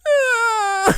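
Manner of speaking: high loud mock "Ah!" scream. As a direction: let out a sudden high yelp. Acted scream in retold story.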